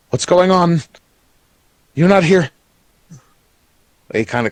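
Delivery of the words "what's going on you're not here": higher, louder nervous questions; a hint of panic; worried goblin. Goblin noble stepping back from Dirk, nervously higher. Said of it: "he looks around all worried".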